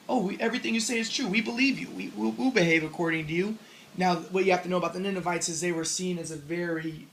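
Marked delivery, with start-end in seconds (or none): 0.1-3.6 higher, eager crowd voice gushing yes; eager agreement. Retold or imitated. Ninevites gushing agreement to Jonah, eager and higher.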